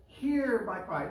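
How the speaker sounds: "Hear" drawn out high and loud; emphatic desperate plea. Acted. Preacher showing David's emphasis, "Hear" stretched and loud. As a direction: lean hard and slow on "Hear". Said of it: "is a prayer of desperation".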